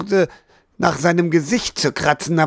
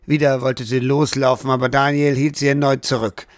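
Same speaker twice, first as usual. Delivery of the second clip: ordinary delivery, nothing marked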